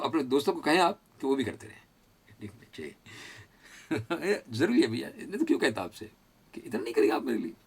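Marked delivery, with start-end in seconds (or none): none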